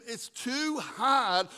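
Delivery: high, drawn-out mock protest; doubting complaint. Struggling believer protests to the Lord, drawn-out complaint.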